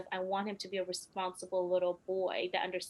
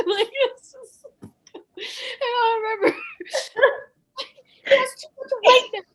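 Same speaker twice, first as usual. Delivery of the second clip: tearful laughter; 12 dB louder than usual. Real, not acted. Crying, laughing, tearful retelling.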